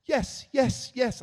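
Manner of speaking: high, very loud ecstatic yeses; over-the-top, high-pitched emphatic agreement; Meg Ryan. Retold or imitated. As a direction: mimic the diner scene with loud, emphatic cries. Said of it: "he sounded like Meg Ryan from the movie".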